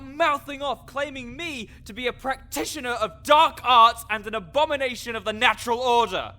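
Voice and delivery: high, loud mock apology turning into a rant; sarcastic indignation. Necromancer ranting at the rude adventurer, sarcastic. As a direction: sneer the apology, then vent.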